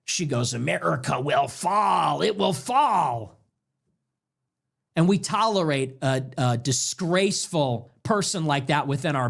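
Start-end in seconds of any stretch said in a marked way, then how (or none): 0.5-3.4 an ominous mock curse, stretching the last FALL; contemptuous. Host mimics a woman cursing the nation, contemptuous. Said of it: "You can hear the contempt".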